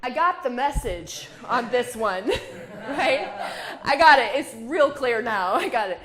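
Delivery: delighted loud outburst laughing at the sign; laughing exclaim. Retold or imitated. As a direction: shout it out with a laugh, message received. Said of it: "And I went".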